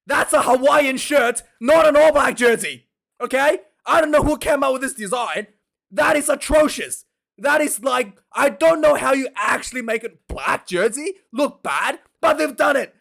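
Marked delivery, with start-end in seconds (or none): 0.0-9.3 higher, louder indignant complaint; disgusted rant. Real, not acted. Fan ranting to viewers about the ugly jersey, high and indignant. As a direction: sound outraged at the design.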